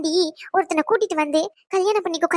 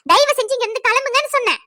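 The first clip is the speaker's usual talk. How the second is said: raised, higher-pitched demand; angry insistence; 5 semitones higher, 3 dB louder than usual. Acted. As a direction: insist they leave, temper rising. Repeats order to leave, angrier.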